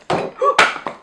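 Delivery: very high sharp unvoiced gasp; sudden high breathy gasp of alarm; startled gasp. Real, not acted. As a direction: gasp as something falls. Startled mid-talk.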